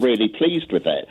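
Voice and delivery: normal talking voice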